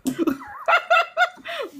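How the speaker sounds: real high burst of laughter. Real, not acted. Laughing at her own retold outburst, high laugh. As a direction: laugh up high after the shout.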